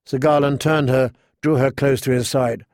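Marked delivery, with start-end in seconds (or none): none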